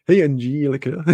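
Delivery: jokey greeting with a long JEE in Angelica; playfully weird mispronunciation. Pretend. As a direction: stretch the EE of Angelica for a laugh. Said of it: "being a bit weird".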